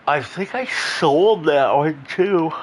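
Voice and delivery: words stretched slowly through a real yawn; sleepy yawn dragging through the line. Real, not acted. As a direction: talk while mid-yawn, slow and drawn out. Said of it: "I'm yawning".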